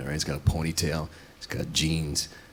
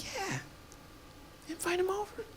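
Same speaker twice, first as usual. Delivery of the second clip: much higher, very soft, sugary falsetto; fake cheerful agreement; 6 dB quieter than usual. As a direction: agree with forced sweetness. Fake-agreeable reply to her idea.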